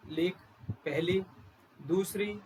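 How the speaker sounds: ordinary talk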